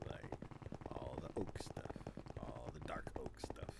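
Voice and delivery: whisper. Real, not acted. Whispered list of wood types.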